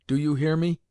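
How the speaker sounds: mildly stern demand; stern, peremptory. A narrator's reading. Grandmother pointing the boy to his tutor, stern and peremptory. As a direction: press for obedience, peremptorily. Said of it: "in a stern and peremptory tone".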